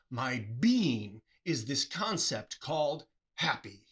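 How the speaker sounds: slow and deliberate, each word weighed; measured explanation; slow emphasis. Shown as a demonstration. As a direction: spell out the meaning slowly. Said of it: "what was actually said... is".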